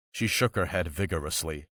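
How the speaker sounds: plain reading voice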